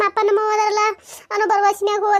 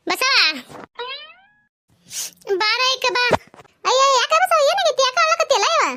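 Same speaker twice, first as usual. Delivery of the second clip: a higher voiced village woman, calling out in concern; a second woman's voice, higher, asking why she cries; concerned neighbour; 7 semitones higher than usual. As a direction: call out to her friend, worried. Neighbour woman calls, asks why crying.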